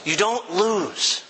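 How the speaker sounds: slow, choked, voice catching; tearful and slow; emotional. Real, not acted. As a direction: hold back tears on each word. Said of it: "so I'm emotional".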